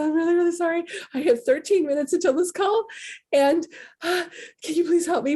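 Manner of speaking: high, pleading and frantic; desperate pleading. Retold or imitated. Calling Amazon support minutes before a call, high desperate pleading.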